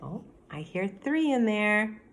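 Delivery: usual speaking voice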